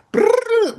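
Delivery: high trilled tape-rewind sound; rewind noise. Pretend. Host pretending to rewind the podcast, imitated tape whir.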